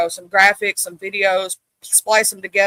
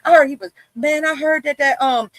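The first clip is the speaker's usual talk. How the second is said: boisterous room greeter; 8 semitones higher than usual. Retold or imitated. Doing herself walking into a room, a hammed-up boisterous greeting. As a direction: burst in like the loudest person in the room. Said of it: "We like".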